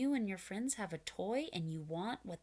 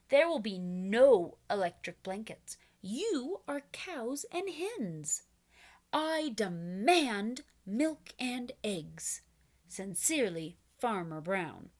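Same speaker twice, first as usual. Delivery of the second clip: stern demand; 5 dB louder than usual. Acted. Farmer dictating a stern letter to cows and hens, firm and loud. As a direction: lay down the law.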